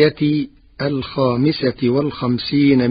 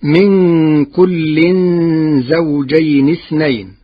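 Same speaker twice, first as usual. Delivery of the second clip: Quran recitation; 4 dB louder, slower than usual. Acted. Recites the verse.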